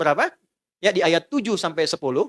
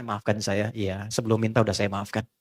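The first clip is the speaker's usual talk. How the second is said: gentle apology; 11 semitones lower, 5 dB quieter than usual. Retold or imitated. Spouses reconciling, voiced quietly.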